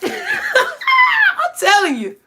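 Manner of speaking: cracking up, squealing high and slow; laughing. Real, not acted. Cracking up after mimicking the church ladies, laughing. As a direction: laugh through the words.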